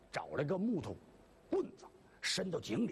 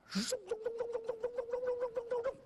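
rapid high chanted running noises; running sound effect; faster than usual. Acted. Storyteller mimics Wang Ping running off south, high rapid chant. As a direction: rattle off the footsteps high and fast.

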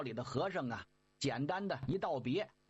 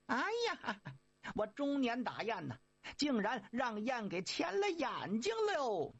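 rueful lament; 6 semitones higher than usual. Acted. Monkey lamenting being tricked, high and rueful. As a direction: kick yourself for being fooled.

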